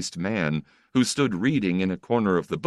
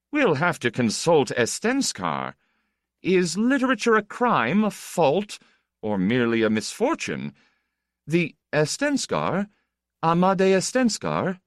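higher, playful nobleman teasing; teasing count, higher voice; 10 semitones higher than usual. Acted. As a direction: tease him like a bored aristocrat.

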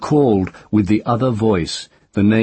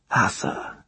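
unvoiced, softer whispered name; 3 semitones higher, 5 dB quieter than usual. Child whispers the witch's true name. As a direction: breathe the name.

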